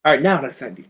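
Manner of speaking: normal talking voice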